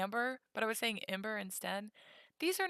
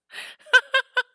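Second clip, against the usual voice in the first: loud, high burst of laughter; 18 dB louder than usual. Real, not acted. Streamer laughing at own bit, loud high laugh. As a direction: crack up at your own French accent. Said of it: "laughs at own accent".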